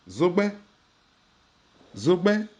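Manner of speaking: Zogbe said high with a rising tone. Shown as a demonstration. Teacher models the tones of Zogbe for learners. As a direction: lift Zogbe up into a clear rising tone. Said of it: "avec les intonations bien entendu".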